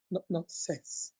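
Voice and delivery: very soft, slow aside; a low grumbling nonsense; muttered dismissal. Real, not acted. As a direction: drop it under your breath.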